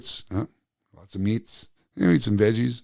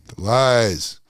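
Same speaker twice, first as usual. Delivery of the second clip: indignant louder echo of "The lies"; louder outraged repeat; 3 semitones higher, 6 dB louder than usual. Acted. Dramatic repeat of a phrase.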